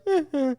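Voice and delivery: high numb "eh, eh"; numb-mouthed shrug noise. Retold or imitated. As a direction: grunt through a numb mouth. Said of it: "I was just like".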